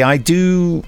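usual speaking voice

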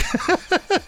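high-pitched burst of a giggle; high squeaky giggle. Real, not acted. Composer laughing at his own complaint, squeaky giggle.

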